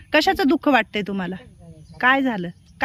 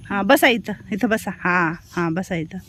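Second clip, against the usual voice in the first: gentle settling; 7 semitones lower, 4 dB quieter, slower than usual. Real, not acted. Settling elder in seat.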